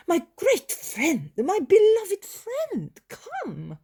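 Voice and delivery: fawning plea. Retold or imitated. Isaac runs after to wheedle.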